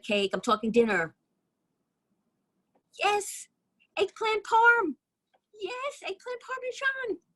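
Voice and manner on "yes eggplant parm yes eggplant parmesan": very high, drawn-out delighted squeal; excited squeal. Acted. Friend confirming the dinner special over the phone, squealing with excitement. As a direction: squeal the dish name slowly, way up high. Thrilled reveal of friend's favorite dish.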